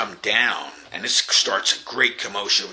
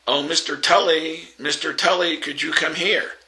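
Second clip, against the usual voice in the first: calling out, higher and louder across the garden; raised voice; 7 semitones higher, 4 dB louder than usual. Retold or imitated. Wife summoning her bodyguard across the lawn, raised voice. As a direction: call the agent over from thirty yards, voice raised. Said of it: "raising her voice".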